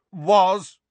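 ordinary delivery, nothing marked

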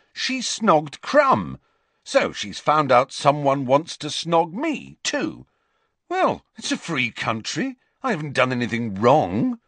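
put-on sulky teenage boy, resentful and defensive; defensive Ron. A narrator's reading. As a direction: grumble it like a hurt teen. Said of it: "defensive and resentful tone".

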